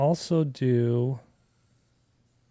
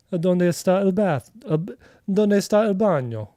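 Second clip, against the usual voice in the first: higher and louder, Spanish with a hammed-up Italian accent; 8 semitones higher, 8 dB louder than usual. Streamer asking for the bathroom, hammed-up Italian accent on Spanish. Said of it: "a little bit of Spanish with an Italian accent".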